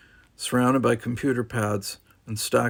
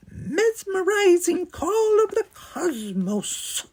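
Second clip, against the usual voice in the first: very high, slow dreamy squeak; high squeaky voice; much slower than usual. Acted. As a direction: say it high, lost in wonder. Tronald quoted, high voice.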